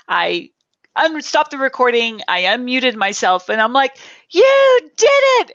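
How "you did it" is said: much higher, louder joyful shout; excited cheer. Retold or imitated. Cheering the guest who just finished her talk, excited shout. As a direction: cheer her on with huge delight.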